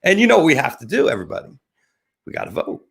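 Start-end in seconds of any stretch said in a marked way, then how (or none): 2.2-2.9 whispery, soft reminder; hushed aside. Real, not acted. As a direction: drop to a near whisper, as if confiding. Hushed setup before urging listeners.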